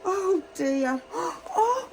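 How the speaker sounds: bright, sing-song greetings to each toy, gasping with delight; children's entertainer voice, big and eager; excited kids' presenter. Acted. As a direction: wow the little ones as you greet every guest. Kids' Christmas show presenter.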